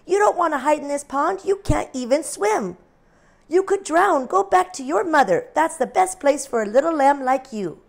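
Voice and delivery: high, loud motherly duck; fussing duck mom. A narrator's reading. Mommy Duck scolding the lamb to go home, high. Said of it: "said Mommy Duck".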